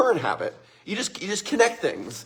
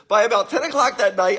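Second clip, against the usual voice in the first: ordinary delivery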